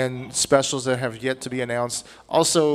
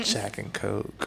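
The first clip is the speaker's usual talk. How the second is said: ASMR murmur; 4 semitones lower, 8 dB quieter, much slower than usual. Acted. ASMR food whisper bit.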